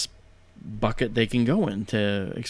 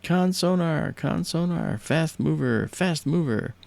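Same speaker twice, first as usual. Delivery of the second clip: raised, clipped sonar call-out; mild retold yell; 7 semitones higher, slower than usual. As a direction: call it out to the control room, half-voiced. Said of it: "And he yells out".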